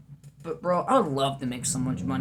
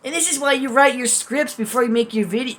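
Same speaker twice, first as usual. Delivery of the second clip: much higher, louder, put-on scolding voice; mock lecturing voice; 9 semitones higher, 8 dB louder than usual. Teasing herself about scripting, in a higher, louder mock voice. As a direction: scold yourself in a shrill put-on tone.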